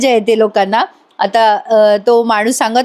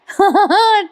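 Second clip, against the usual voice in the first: high giggling burst; amused high-pitched laughter; real laugh; 9 semitones higher than usual. Real, not acted. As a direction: laugh out at your own impression. Laughs at own mimicry.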